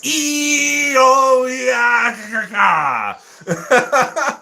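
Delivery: high, drawn-out kaiju screech; playful monster roar ending in cackles; imitated monster cry. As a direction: screech like a movie monster, slowly falling. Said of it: "the cries of the monsters".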